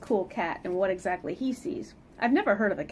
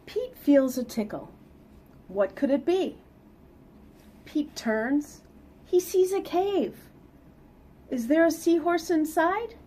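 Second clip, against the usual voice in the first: lively read-aloud storytelling; picture book reading voice; story narration; 8 semitones higher, 6 dB louder, slower than usual. Acted. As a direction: read it to the kids with bounce.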